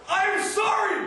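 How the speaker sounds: high, loud holler from the rooftop. Retold or imitated. [name] imagines shouting an apology from the rooftop, loud holler. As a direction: yell it out over the city.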